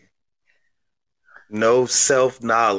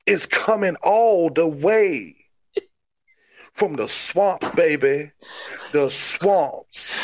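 crazy Cajun; 2 semitones higher than usual. Acted. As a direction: brag like a swamp wrestler in Cajun accent. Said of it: "You're a crazy Cajun".

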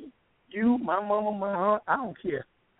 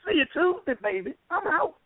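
excited sign-off; 11 semitones higher than usual. Real, not acted. Caller signs off excitedly.

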